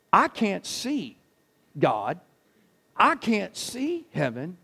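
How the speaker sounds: ordinary delivery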